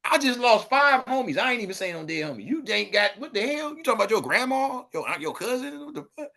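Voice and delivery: high-pitched, incredulous comic rant; mock outrage. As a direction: protest in a high, disbelieving voice. Acted outraged reply to fake gangbangers.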